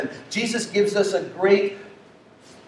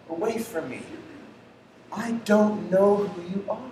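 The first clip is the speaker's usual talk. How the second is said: quiet verdict; 8 dB quieter, faster than usual. Retold or imitated. Jesus' verdict, dropped quiet.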